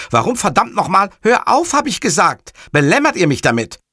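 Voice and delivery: loud, high-pitched irritation; angry. Acted. Montalbano swears down the phone, loud and angry.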